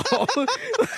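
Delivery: real high burst of laughter; laughing at his friend. Real, not acted. Laughs in retelling.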